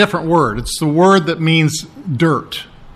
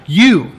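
YOU said firmly, standing alone; emphatic YOU; 3 dB louder than usual. Shown as a demonstration. Preacher repeats the verse's pronoun for effect, emphatic YOU. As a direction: hit YOU hard and let it fall. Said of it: "very emphatic".

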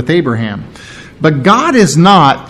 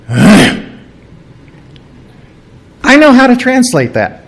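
exasperated; 7 semitones higher than usual. Retold or imitated. Exasperated mom at muddy floor.

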